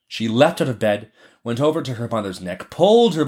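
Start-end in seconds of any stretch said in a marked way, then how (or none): none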